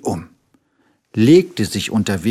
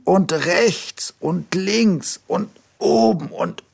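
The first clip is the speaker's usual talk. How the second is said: higher, slow listing of every sore spot; higher plaintive tiger, drawn out; whiny little tiger; 7 semitones higher than usual. A narrator's reading. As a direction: pile on the complaints like a hurt cub. Tiger shows all sore spots.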